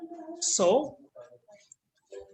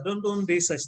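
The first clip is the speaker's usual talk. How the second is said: ordinary talk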